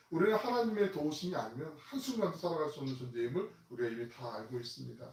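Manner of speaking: ordinary delivery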